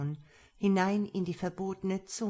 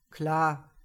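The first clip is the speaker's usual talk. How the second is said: short, louder growl of a masked man; a gruff one-word answer, pushed out; 2 semitones lower, 5 dB louder than usual. A narrator's reading. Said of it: "knurrte der Vermummte".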